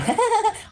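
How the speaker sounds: short high burst of laughter. Real, not acted. Vlogger mocking her rivals, short high laugh. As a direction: let out a quick high laugh.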